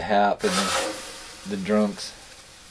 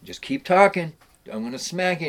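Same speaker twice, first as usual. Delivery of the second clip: threat; 7 semitones higher, 5 dB louder than usual. Retold or imitated. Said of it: "he's like".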